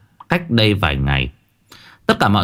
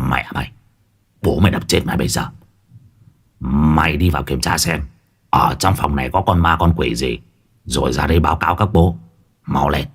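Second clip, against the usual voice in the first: lower, fast bullying threat; gruff threatening shaman; 6 semitones lower, faster than usual. A narrator's reading. Môn Phọt threatens the ghost, low, fast and gruff. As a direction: bark down at the ghost like a rough shaman.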